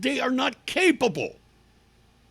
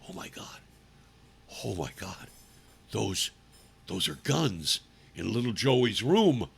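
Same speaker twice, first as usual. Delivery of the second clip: whispered, much lower, awed; hushed shock of the principal; mock stunned principal; 10 semitones lower, 5 dB quieter than usual. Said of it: "he looks and goes".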